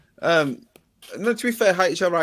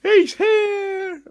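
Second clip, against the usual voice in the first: excited announcement; 7 dB louder, much slower than usual. Real, not acted.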